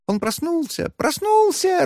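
high, squeaky and joyful; squeaky fairy voices. A narrator's reading. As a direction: squeal it like tiny fairies. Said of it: "радостно запищали феи".

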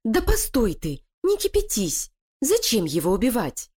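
high, sly voice of a plotting wife; scheming female voice. Acted. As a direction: persuade her partner, cold and cunning. Лилия останавливает Кокоса. (коварная интриганка)

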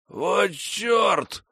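high, wild madman shout; crazed exclamation. A narrator's reading. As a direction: shriek it like a madman. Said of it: "безумно захохотал".

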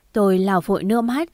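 ordinary delivery, nothing marked

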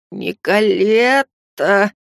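slow, drawn-out warning growl. Acted. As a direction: growl her name as a warning. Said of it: "предупреждающе прорычал мясник". (предупреждающее рычание)

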